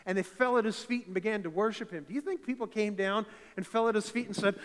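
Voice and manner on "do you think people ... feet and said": loud, higher rhetorical question; preaching challenge. Real, not acted. Pastor challenging the church's idea of worship, loud. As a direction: throw the question at them.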